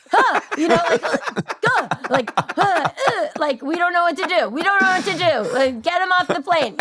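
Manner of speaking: high, flustered flight attendant; mock panic, high and helpless. Flight attendant stuck with the doll's name, high mock panic. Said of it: "And they'd always be like".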